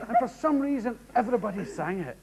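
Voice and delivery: ordinary talk, nothing marked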